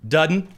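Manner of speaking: "dudden" said for didn't, rural Midwest; Indiana dialect pronunciation. Shown as a demonstration. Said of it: "instead of didn't".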